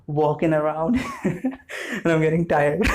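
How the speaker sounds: slow, laughing admission of tiredness. Real, not acted. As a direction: let a laugh break through the words. Laughs while saying she's tired.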